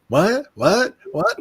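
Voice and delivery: rising high mock what, repeated; mock astonishment. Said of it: "the Israelis are going".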